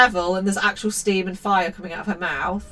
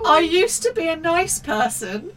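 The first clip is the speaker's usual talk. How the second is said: slow hammed-up moan, a little higher; mock moaning mum; 7 semitones higher than usual. A narrator's reading. Mother reading the mum's line, mock moaning. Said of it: "she moaned".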